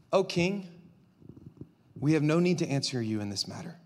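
low, calm, unexcited reply; kind, respectful. Acted. Young men answer the raging king, calm and respectful. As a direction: answer gently, no exclamation points. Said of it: "You hear their tone. No exclamation points".

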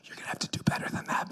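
fast whisper; whispered aside. Retold or imitated. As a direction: hiss it to your neighbour in disbelief. Soldier's skeptical aside.

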